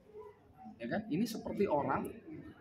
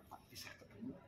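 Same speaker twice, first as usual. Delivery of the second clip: a breathless, voiceless whisper of a shy request; imitated whisper; 19 dB quieter than usual. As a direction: barely breathe the words, almost inaudible. Cold man suddenly asks quietly. (bisikan pelan tanpa suara)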